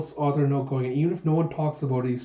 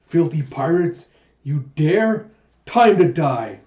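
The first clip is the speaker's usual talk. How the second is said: acted indignant challenge, building louder; hostile threat; 6 dB louder, slower than usual. An official challenging filthy pirates, acted angry threat. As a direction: build to a loud mock battle cry.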